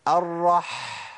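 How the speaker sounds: "الرح" with a long held rasped h; slow demo stopping on ح in "الرح"; mid-throat ha. Shown as a demonstration. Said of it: "في منتصف الحلق وقول ايه؟".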